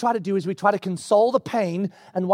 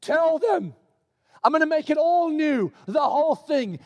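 raised, eager confiding voice of God; 10 semitones higher than usual. Acted. God leaning toward John, a lightly raised eager voice. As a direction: lean in and tell him excitedly. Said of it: "God's kinda leans forward and says".

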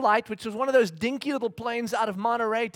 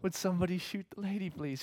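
much softer, fast muttered wish; quiet aside; 2 semitones lower, 10 dB quieter than usual. Retold or imitated. Fed-up dad joking about the flight attendant, soft and quick. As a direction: toss it off under your breath. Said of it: "you're like".

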